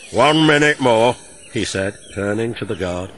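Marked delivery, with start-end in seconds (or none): 0.1-1.2 Fat Controller. A narrator's reading.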